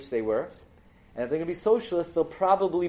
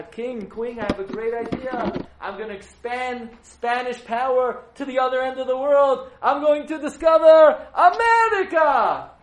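grandiose explorer; 9 semitones higher, 8 dB louder than usual. Acted. Columbus boasting to the king and queen, grandly loud. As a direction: announce it like destiny itself.

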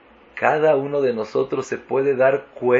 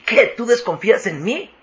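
indignación incrédula; 10 semitones higher than usual. Retold or imitated. Indignado porque desconfían de él.

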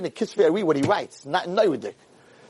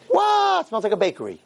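startled; 7 semitones higher than usual. Acted. Gabbai startled by bakery smell.

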